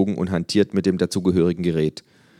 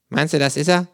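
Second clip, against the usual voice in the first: higher, louder curious kangaroo; 10 semitones higher, 5 dB louder than usual. Acted. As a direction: perk up like a nosy kangaroo. Känguru voiced by narrator.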